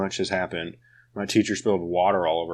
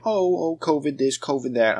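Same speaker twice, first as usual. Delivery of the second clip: high, whiny mocking voice; mock whiny teacher; 7 semitones higher than usual. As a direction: mimic excuse-making teachers. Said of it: "the teachers just blame".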